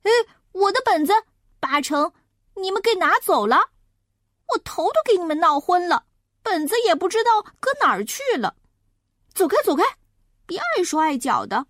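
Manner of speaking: high, fast, cross outburst; a flustered big brother blaming the little ones; venting at siblings. A narrator's reading. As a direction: rattle it off high and irritated. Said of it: "准得拿他们出气".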